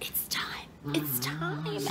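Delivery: soft, hushed, creepy whisper; spooky whisper. Acted. Host to viewers, whispering a spooky teaser. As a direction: whisper like a horror trailer.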